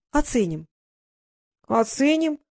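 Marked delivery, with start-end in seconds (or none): 1.7-2.4 high, incredulous question; disbelieving, voice pitched up; astonished echo. A narrator's reading. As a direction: throw the word back in amazement. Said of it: "изумился Фэш".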